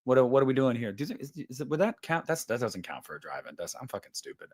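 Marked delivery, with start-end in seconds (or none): 2.4-4.5 barely voiced, muttered under the breath; self-deprecating mutter. Real, not acted. Streamer mutters to himself after a mix-up, whispered. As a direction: scold yourself quietly, almost silent.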